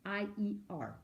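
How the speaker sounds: H E A V I E R read slowly letter by letter; dictating the letters; spelling out letters. Shown as a demonstration. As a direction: spell it out slowly for the class to write. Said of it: "I write heavier".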